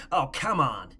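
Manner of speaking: mock dismissive fan. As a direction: scoff it loudly like a dismissive fan. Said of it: "you won't hear anybody say".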